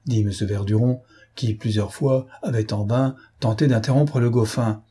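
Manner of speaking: ordinary delivery, nothing marked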